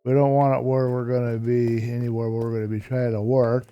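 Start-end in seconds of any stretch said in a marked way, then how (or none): none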